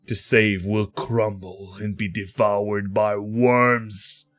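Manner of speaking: slow, ominous villain swelling loud, then trailing off; foreboding intoning. Acted. Dark voice tells the Changebringer all is futile, foreboding. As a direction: intone doom slowly, surging to a peak and sinking away. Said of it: "the voice intones".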